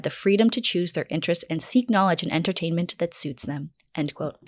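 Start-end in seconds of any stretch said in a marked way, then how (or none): none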